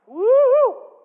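high, loud whoop of self-congratulation; a big triumphant whoop; mock cheer. A young pastor mocking himself with a cheer. Cheering at his own plan.

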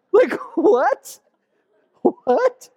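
high, incredulous what; mock disbelief. Preacher reacts to the gardener idea, high and incredulous. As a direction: gasp it out in playful disbelief.

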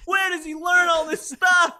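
loud amazed ringside call; excited fight commentator. Pretend. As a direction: shout in awe at the fighter.

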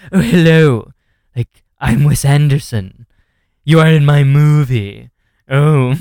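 high, loud, drawn-out mannered caricature; hammed-up imagined voice of a film director; Wes Anderson. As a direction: put on an affected character voice, stretched and loud. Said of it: "how he looks like he would sound".

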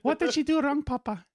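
put-on squeaky high little kid voice; high child voice. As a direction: pitch way up like a small child. Child role repeated, higher.

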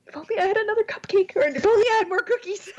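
high, quick joking whine that breaks into laughter; laughing through tears. Real, not acted. Co-host wishing for cupcakes to cheer up, laughing. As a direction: laugh it off while a little teary.

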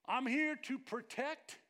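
slow, loud, menacing guard announcing his duty; stern temple guard. Retold or imitated. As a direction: lay it down like an armed guard warning you off. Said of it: "They would have said something like".

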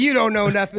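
mock squabble. First of two know-nothings bickering, mock accusation. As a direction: throw it out as a hammed-up quick retort.

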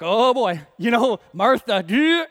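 loud, high mock alarm; tense reaction. Guys watching Martha get upset, loudly alarmed. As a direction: play the guys bracing for trouble. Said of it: "Everybody's feeling the tension".

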